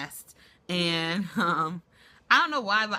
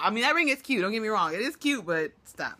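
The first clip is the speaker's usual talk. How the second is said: ordinary delivery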